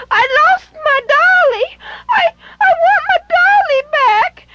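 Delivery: put-on a little girl sobbing that she lost her doll; crying little girl. A narrator's reading.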